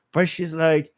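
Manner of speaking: normal talking voice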